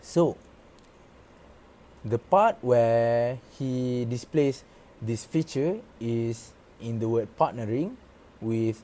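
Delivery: usual speaking voice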